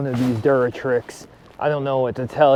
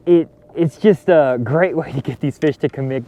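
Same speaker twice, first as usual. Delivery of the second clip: usual speaking voice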